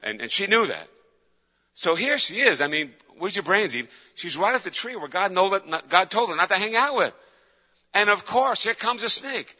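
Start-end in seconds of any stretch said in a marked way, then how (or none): 1.7-9.5 higher and louder, building indignation; rising excitement. Acted. Preacher telling Eve's story to the church, climbing loud.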